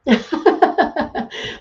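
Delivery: high burst of real laughter; amused laugh. Real, not acted. Amused by a viewer's comment.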